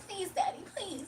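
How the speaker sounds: high, pleading mock whimper; begging voice. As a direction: beg like a needy pet. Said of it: "He was like".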